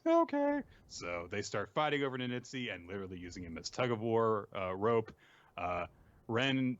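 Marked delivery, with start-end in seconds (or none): 0.1-0.6 high, squeaky character voice. Retold or imitated. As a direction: agree in a very high little voice. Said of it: "and Ninitsu goes".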